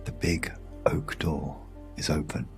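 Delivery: ordinary delivery, nothing marked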